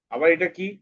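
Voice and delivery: ordinary talk, nothing marked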